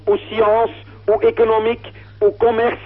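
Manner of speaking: normal talking voice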